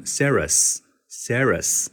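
Sarahs with an open "sair" vowel, no "ee"; wrong Sarahs. Shown as a demonstration. Teacher demonstrates the mistaken reading Sarahs. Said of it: "有些人把它读成".

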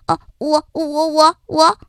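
nervous stammer, stuck on the first word; stutter, caught out. Acted. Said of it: "说起话来结结巴巴的". (黑黑心慌地结巴)

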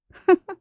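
short, high scornful snicker; cold sneering laugh. A narrator's reading. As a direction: let out a derisive little laugh. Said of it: "皇后冷笑了一声".

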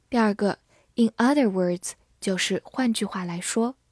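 ordinary talk, nothing marked

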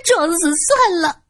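high, mock-tearful shrew yelling; shrill shrew's wail. A narrator's reading. 泼妇冲着年轻警察撒泼，高声假哭. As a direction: whine like a brazen shrew playing victim. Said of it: "嘴里叫着".

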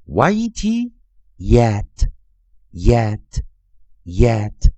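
very slow spelled-out yet, each yet stretched; spelling drill. Shown as a demonstration. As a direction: spell it, then chant yet three times for students. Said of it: "再跟老师念一遍".

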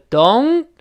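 don't with a long rising "oh-n"; drawn-out high don't; diphthong in don't. Shown as a demonstration. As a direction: stretch the o of don't, close on n. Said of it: "还得注意是双元音o".

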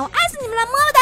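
squealed out high, blowing a kiss; gushing love to listeners. Real, not acted. Host gushing love at her fans, giddy high squeal. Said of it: "深情的么么哒".

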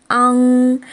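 昂 held long into a back nasal; vowel-final blend. Shown as a demonstration. Teacher blending vowel and final first, 昂 drawn out. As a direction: stretch 昂 out, ending on ng. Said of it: "先拼读元音和收音".

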